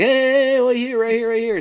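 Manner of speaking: high, loud rapid-fire offers; excited small-town crowd calling over each other; eager calling out. Acted. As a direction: clamour to be noticed first. Townspeople all offering their papers.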